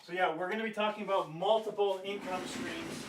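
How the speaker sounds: talking from across the room; away from mic. Real, not acted. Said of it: "I'm gonna keep talking while I run to do one thing".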